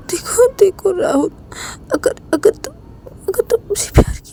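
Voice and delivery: high, crying voice begging Rahul; tearful plea. Acted. Ravi begs Rahul to love him, crying. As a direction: plead through tears, voice cracking upward. Emotional plea before suicide threat.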